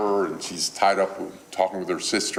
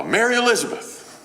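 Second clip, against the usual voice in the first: high-pitched mock summons; hammed-up mom calling her kid, pitched way up; mother using middle name; 3 dB louder than usual. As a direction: call your child sternly by both names. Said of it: "the mother employed the middle name".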